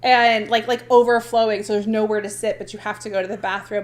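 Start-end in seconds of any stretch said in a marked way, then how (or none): none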